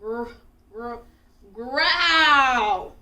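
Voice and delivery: building growl. A narrator's reading. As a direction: let the growl swell to a roar. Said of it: "got louder and louder".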